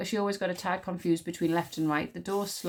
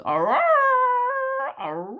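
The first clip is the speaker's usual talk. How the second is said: triumphant howl; 8 dB louder than usual. A narrator's reading. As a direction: throw your head back and howl. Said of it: "threw back his head and howled".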